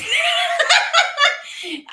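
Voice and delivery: shrieking burst of laughter; loud high laugh. Real, not acted. Reacting to a viewer's joke with a loud high laugh. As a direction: let a big high cackle out.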